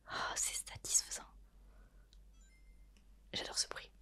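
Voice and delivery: ordinary talk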